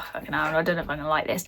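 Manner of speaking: low, soft inner-thought voice; muttered doubt. Retold or imitated. Reader's first thought on the synopsis, low doubtful mutter. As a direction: grumble it quietly to yourself.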